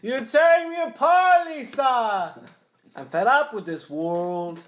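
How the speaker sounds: loud, high, anguished movie melodrama; hammed-up tormented outburst, thick odd accent; Tommy Wiseau. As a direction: wail it slowly like a terrible overacting actor. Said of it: "ready to do my impression".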